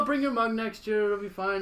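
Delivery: high, sugary neighbour lady; sweet hostess voice. Retold or imitated. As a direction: coo it like a cheerful hostess. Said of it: "She goes".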